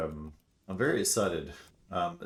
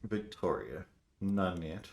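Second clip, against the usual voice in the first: ordinary delivery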